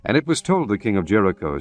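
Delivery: usual speaking voice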